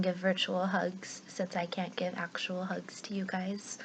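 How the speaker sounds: soft whisper, slow and intimate. Real, not acted. Teacher sends virtual hugs softly.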